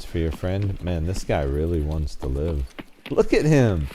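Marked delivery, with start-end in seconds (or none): 3.1-3.9 excited. Real, not acted. Mocking fleeing enemy.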